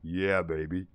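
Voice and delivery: low, slow crooner voice. Acted. Drop down into a deep lover-man voice. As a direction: streamer showing off the new mic, deep soul-singer impression.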